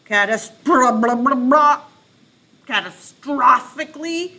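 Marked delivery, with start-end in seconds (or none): 1.0-1.8 loud bubbly blums; loud underwater blubbing. Shown as a demonstration. Teacher reads underwater, loud bubbly blums. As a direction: gurgle it out loud. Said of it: "pretend to be underwater".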